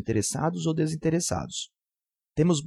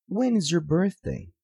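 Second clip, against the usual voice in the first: slow question, "birthday" drops at the end; falling intonation; 5 semitones higher, slower than usual. Shown as a demonstration. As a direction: start "When" high and let "birthday" fall. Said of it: "nós baixamos a voz no final da frase". (entonação decrescente em "When is your birthday?")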